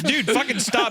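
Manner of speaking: high-pitched imitation of alarmed classmates; freaking out. Storyteller voices classmates begging the kid, high freaked-out plea. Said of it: "freaking out. Everyone's like".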